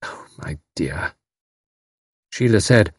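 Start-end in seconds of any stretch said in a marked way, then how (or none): 0.0-1.1 soft, slow and helpless; softer, slower sympathy for a grieving girl. A narrator's reading. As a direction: murmur it with nothing left to offer. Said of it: "She said helplessly".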